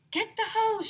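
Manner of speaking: high urgent call; urgent shout. A narrator's reading. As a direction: bark the order. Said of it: "shouted one of the firefighters".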